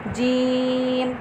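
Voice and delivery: "जीम" stretched very long and soft; long drawn letter. Shown as a demonstration. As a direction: hold "जीम" for three alifs. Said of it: "तीन हरफ अलिफ के बराबर लंबा किया।". ("जीम" तीन अलिफ के बराबर खींचें)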